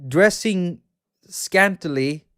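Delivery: normal talking voice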